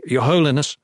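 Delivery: urgent call, a touch breathless; breathless, distressed. A narrator's reading. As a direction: burst in with mild distress. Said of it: "breathless and distressed".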